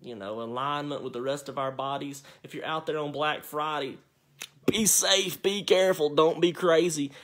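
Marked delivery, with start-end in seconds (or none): 4.7-7.1 louder, higher caring call; loud warning. Real, not acted.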